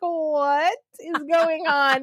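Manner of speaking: very drawn-out, imitated bewilderment; baffled disbelief. Her husband stares at her, slow mock bafflement. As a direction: stretch each word as a stunned husband.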